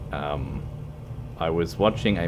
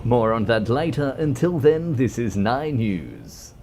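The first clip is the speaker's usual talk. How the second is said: loud, higher mock news-anchor sign-off; 4 semitones higher, 3 dB louder than usual. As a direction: sign off like a TV newsreader, lightly hammed. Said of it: "It's like a news broadcast".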